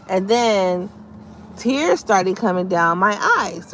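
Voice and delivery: normal talking voice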